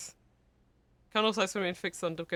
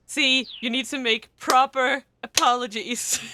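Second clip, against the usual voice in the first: mock lecture; 4 semitones higher, 7 dB louder, slower than usual.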